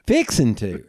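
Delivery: RECKON tossed up high, mimicking; amused echoing. Friend repeating a Southern word, mock amazed. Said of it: "that dude kept going".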